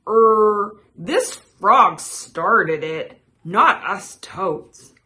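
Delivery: loud, slow, hesitant excuse; frightened. A narrator's reading. Toad squirming before the creature, slow nervous excuse. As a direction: stall on the first sound, then shift blame slowly. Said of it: "squirmed in fright".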